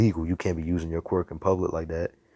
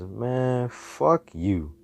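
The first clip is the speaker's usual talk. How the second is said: drawn-out, dismissive brush-off; dismissive retort; 6 semitones higher, much slower than usual. Acted. Hero to the heroes scolding him, dismissive retort. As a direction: start high on a long "man", then drop.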